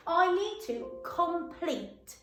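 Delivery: drawn out, word by word; slow read-back. Shown as a demonstration. Teacher reading back the sentence slowly to pupils. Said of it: "reading sentence slowly while writing".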